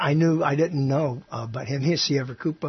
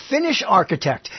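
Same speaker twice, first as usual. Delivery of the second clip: usual speaking voice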